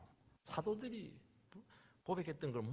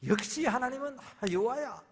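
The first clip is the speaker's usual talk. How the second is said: joyful exclamation; 8 semitones higher, 7 dB louder than usual. Retold or imitated. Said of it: "하나님의 뜻을 발견하고 나서".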